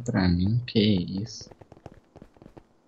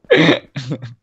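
loud laugh; 9 dB louder than usual. Real, not acted. Player amused while gaming, loud laugh. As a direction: laugh out loud, then ease off. Amused during game play.

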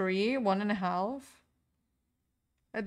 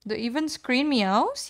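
surprised question; 2 semitones higher, 4 dB louder than usual. Real, not acted. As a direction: ask it in amazed disbelief. Repeats chat question in surprise.

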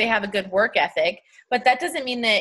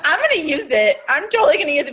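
high, laughing eagerness; giggly excitement; 6 semitones higher, 4 dB louder than usual. Real, not acted. Host delighted to borrow the idea, high and giggly. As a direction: bubble over with delight.

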